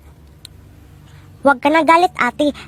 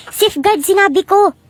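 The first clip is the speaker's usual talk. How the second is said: "Safeguard" punched out higher in pitch; stressed correction; 5 semitones higher than usual. Acted. Customer correcting a mishearing shopkeeper, exasperated stress on "Safeguard".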